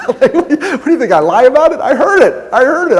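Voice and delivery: high, loud, laughing protest; indignant laughing outburst. Real, not acted. Speaker to crowd, laughing loud and high that the sound guy doubted his story.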